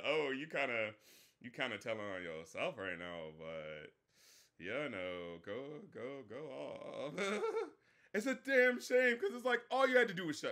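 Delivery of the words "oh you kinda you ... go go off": much lower, softer mocking aside; low, hushed sarcastic put-down; mock sotto voce. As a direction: drop way down and mutter the dig. Mocking quote of the girl.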